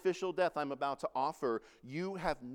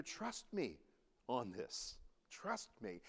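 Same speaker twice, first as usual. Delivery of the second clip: soft, hushed, reassuring Jesus; gentle reassurance; 6 semitones lower, 10 dB quieter, slower than usual. Retold or imitated. Jesus quietly reassures Peter, soft and gentle. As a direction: hush it down like calming a friend.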